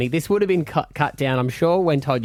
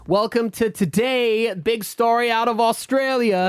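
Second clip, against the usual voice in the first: higher, slow, mock news anchor intro; hammed-up news anchor announcing a big story; newsreader voice; 9 semitones higher than usual. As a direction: open it like a TV news presenter, slow and lifted. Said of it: "it's gonna be like".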